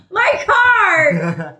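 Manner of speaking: high, loud outraged shout; riled-up yell. Real, not acted. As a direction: scream it in exasperated disbelief. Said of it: "you guys are all riled up now".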